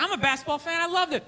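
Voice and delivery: usual speaking voice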